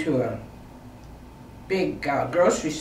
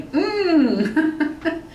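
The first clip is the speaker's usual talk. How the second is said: high, drawn-out mock wince of mm; sudden pained mm; 10 semitones higher than usual. Shown as a demonstration. Woman shows how her neck glitches felt, pained mm. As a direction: demonstrate a sudden twinge, half laughing. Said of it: "where I would just suddenly".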